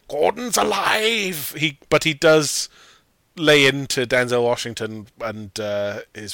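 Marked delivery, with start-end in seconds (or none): 0.1-1.5 hammed-up impression of the famous line; Brian Blessed roar. Podcaster imitating the actor's big line, slow and high. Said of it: "He never goes full, like".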